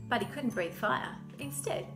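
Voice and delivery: plain reading voice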